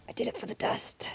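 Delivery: hushed mock-dramatic whisper; playful whisper. Streamer joking to viewers about dust, in a playful whisper. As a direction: whisper it like a silly confession. Playfully repeats own phrase, then again voiced.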